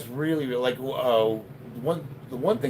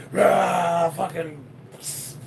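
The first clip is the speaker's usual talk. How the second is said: mock roar; 6 semitones higher, much slower than usual. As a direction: imitate a hyped-up lifter's grunt. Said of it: "they're all like".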